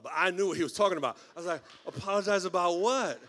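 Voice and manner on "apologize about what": loud, badly faked puzzlement; feigned ignorance. Pastor acting like he didn't know, feigned ignorance. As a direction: play innocent, a bit too loudly. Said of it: "I had to act like I didn't know".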